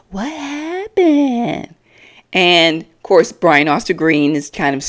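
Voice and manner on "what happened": high, slow, near-silent mouthing; mouthed. Retold or imitated. JoJo mouths a question after Chyna storms out, nearly silent. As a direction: mime the words with barely any voice. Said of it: "JoJo kind of mouthed".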